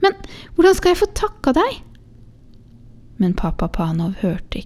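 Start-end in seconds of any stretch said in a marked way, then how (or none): 0.0-1.8 much higher, glad exclaim from the happy mother; joyful cry. Acted. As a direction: cry out with overflowing joy. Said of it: "hun ropte". (glad utrop fra moren)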